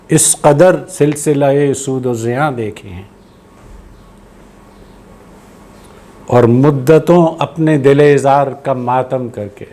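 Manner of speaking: slow, measured Urdu verse recital; poetry recitation. Acted. Host reciting a poet's verse to listeners, measured poetry recitation. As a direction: draw out each phrase, pausing between.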